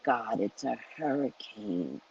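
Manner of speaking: soft, low murmured plea; hushed aside to God about the storm; quiet prayer to God. Retold or imitated. I always talk to God.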